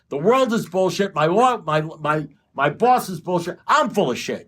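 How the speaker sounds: rising exasperated rant; mounting mock rant. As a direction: pile on the grievances, climbing. Building rant about everything bullshit.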